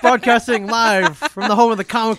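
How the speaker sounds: very loud, high announcer hello; show-opening shout. Acted. Host opens live show to listeners, big announcer shout. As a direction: belt out the podcast intro with a laugh breaking in.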